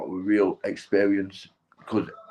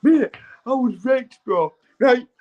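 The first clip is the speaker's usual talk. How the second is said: much higher and slower, dazed and spaced out; dazed wrecked voice; 13 semitones higher, 4 dB louder, slower than usual. Retold or imitated. Recalling the ketamine morning, a dazed high drawn-out voice.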